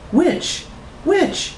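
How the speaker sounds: very high, insistent questions; hammed-up high questioning; desperate repeating. A narrator's reading. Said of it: "had pressed her repeat button".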